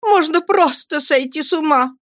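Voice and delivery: put-on high exasperated cry of a spoiled young wife; cried out mother. A narrator's reading. Mother cries out at son and nurse, high and exasperated. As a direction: throw it out in petulant despair. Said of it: "закричала матушка".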